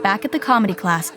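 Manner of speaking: normal talking voice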